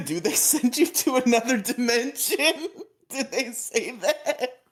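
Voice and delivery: laughing high-pitched question. Real, not acted. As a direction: laugh through the words. Laughing disbelief at claim.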